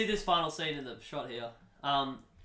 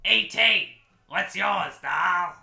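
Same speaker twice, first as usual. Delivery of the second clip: loud, brash call, pitched up; 3 semitones higher, 8 dB louder, much slower than usual. Acted. E T greeting someone new, loud character voice. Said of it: "and then E T goes".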